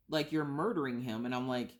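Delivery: ordinary talk, nothing marked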